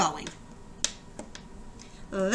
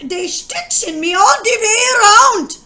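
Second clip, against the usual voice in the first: pained cry; 8 semitones higher, 7 dB louder than usual. Acted. Nails stabbing him, crying out.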